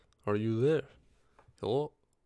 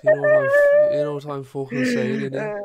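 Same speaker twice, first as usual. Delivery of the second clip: much higher, louder mock Cockney yell; shouted British accent; 11 semitones higher, 10 dB louder than usual. Gamer rants at his teammate, shouting in mock Cockney. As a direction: bellow it like an angry British lad. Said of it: "United Kingdom accent".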